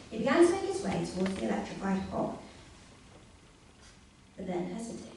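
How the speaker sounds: usual speaking voice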